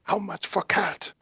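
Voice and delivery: a hint of a furtive buyer; lightly voiced haggler. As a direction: sidle in with a hushed offer. Haggling over cat in character.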